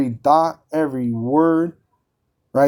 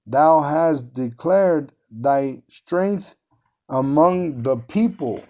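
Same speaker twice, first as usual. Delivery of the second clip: slow scripture reading; much slower than usual. Shown as a demonstration. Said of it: "scripture read slowly".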